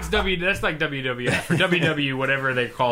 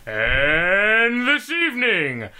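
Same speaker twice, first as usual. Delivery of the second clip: slow, drawn-out ring announcer intro; wrestling announcer; 4 semitones higher, much slower than usual. Retold or imitated. Cohost demonstrating how a wrestling announcer sounds. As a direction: stretch every word like the red corner intro. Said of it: "a wrestling announcer's like".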